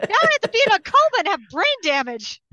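high, loud frustrated whine; Guy Gardner impression. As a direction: whine like a sore loser. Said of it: "Guy Gardner is still in a coma going".

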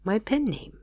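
plain reading voice

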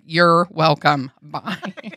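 slow, drawn-out mock politeness; sarcastic. Woman to people thinking her crazy, slow sarcastic sign-off. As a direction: stretch it out loud, then drop to a quick bye. Acted sarcastic reply to doubters.

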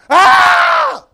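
piercing high scream of agony. Acted. Damned people burning in hell, high agonized scream. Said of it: "people are down there screaming".

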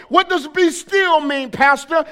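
ordinary talk, nothing marked

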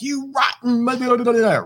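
high, mock furious outburst; angry yell. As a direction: act out losing your temper at your partner. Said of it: "And we yell, we scream".

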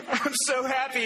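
high, giddy laughter; laughing. Real, not acted. Preacher laughing at the Dr Pepper winner, giddy and high. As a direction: laugh it out, delighted.

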